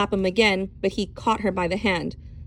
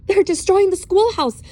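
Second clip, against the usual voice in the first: high, loud, out of breath; breathless panic; 11 semitones higher, 6 dB louder than usual. Acted. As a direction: blurt it out after running. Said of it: "gasping for breath".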